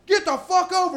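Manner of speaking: high, forceful mock cop shout; cop's yell. Cop yelling at passing drivers to pull over, high acted shout. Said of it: "the cop is in the middle of the highway and goes".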